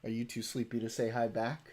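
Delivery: ordinary talk, nothing marked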